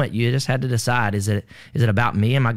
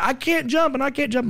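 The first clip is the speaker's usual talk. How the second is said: much higher, frightened refusal; scared girl; 13 semitones higher than usual. Retold or imitated. Girl at the window pleading she can't jump, high frightened voice. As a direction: cry out you can't, voice pitched up.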